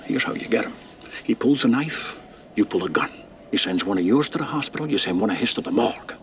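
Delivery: forceful lesson. Acted. Veteran cop telling agent how to get Capone, forceful and fast. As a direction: drive each line home.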